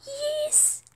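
very high squeal of joy; thrilled squeal. Real, not acted. Player's amazing trade gets accepted, thrilled squeal.

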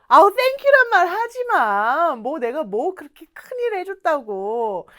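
loud, high, drawn-out brush-off, then settling; effusive acted modesty; protesting the thanks. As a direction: shoo the thanks away emphatically. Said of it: "요럴 때 쓰는 말입니다".

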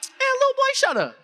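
high-pitched brush-off, dropping at the end; acted irritated put-down; exasperated dismissal. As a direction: wave the kid away. Imagined dismissive reply from vice principal.

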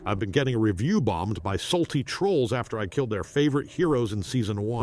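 normal talking voice

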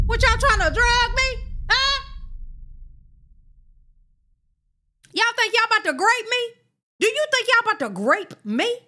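mock alarmed accusation; high suspicious outrage. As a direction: call out the plot, scandalised. Suspects being drugged.

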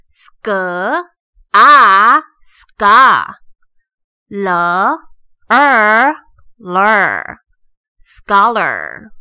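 "scholar" built as "sk", loud "ah", "ska", then "ler"; sounding out syllables. Shown as a demonstration. Teacher builds scholar from its two syllables, sounding out. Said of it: "第一个音节的o是念成".

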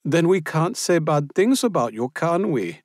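high, loud catty teasing; teasing Austrian woman. A narrator's reading. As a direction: needle her with a sly joke. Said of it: "switched to Hochdeutsch".

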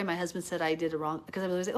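normal talking voice

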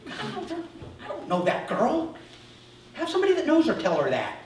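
pleading not to be picked; squeaky complaining to God; protesting to God. Retold or imitated. Preacher voices himself arguing with God.

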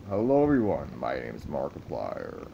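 lightly voiced YouTuber intro, dropping low; Markiplier. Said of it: "Markiplier impression".

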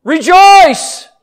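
loud, high shout; sudden pulpit cry, far above normal pitch. Shown as a demonstration. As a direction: shout it out to rouse the congregation. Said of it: "Scares you when a preacher raises his voice".

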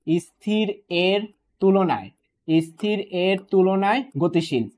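slow, separated words for close attention; word-by-word emphasis. Shown as a demonstration. Teacher dissecting tricky exam wording for students, slow and deliberate. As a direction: spell out the phrase piece by piece.

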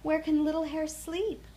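a fretting mother asking for help; a mother hare at a loss, pleading; worried Mother Hare. A narrator's reading. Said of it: "asked Mother Hare".

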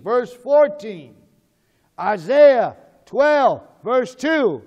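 loud, very slow announcement; calling out references. Real, not acted. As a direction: boom each reference out slowly, word by word.

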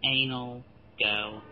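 low, spaced-out seductive count; mock-sexy countdown. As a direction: count down in a low, jokingly sultry voice. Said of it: "one of my sex countdowns".

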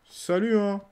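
playful shouted hello; cheeky hello again. Pretend. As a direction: sing out a mocking hi. Repeats greeting to characters.

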